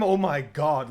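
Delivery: high, loud, slow disbelief; a crowd of gods hearing terrible news, shocked and loud; shocked outrage. Retold or imitated. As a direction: stretch it out, appalled and incredulous. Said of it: "everyone goes like".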